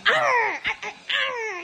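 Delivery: giggling through mock pirate arrs; playful hammed-up pirate; laughing pirate growl. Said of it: "you have to stop talking like that".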